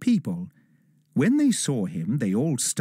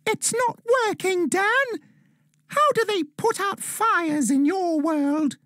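elf calling out in alarm, not full shout; worried shout. A narrator's reading. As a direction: elf shouting to Dan as fire spreads. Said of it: "The elf was worried and shouted".

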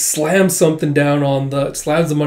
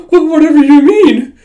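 panicked denial; 13 semitones higher, 11 dB louder than usual. Acted. Caught lying, nervous denial.

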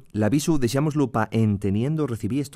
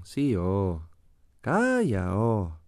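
"Calla" rises, then falls away into a low "oh"; Asturian falling intonation; 4 semitones lower, much slower than usual. Shown as a demonstration. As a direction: end "Sí, oh" and "Calla, oh" on a dropping melody. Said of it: "característico descenso habitual que tenemos en la melodía". («Sí, oh», «Calla, oh» con caída final asturiana)

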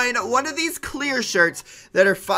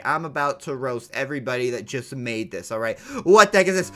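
usual speaking voice

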